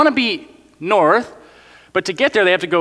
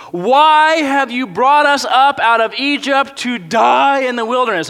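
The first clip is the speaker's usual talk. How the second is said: higher, slow whiny complaint; 4 semitones higher, 3 dB louder than usual. Acted. Pastor voices the grumbling Israelites to Moses, whiny and high.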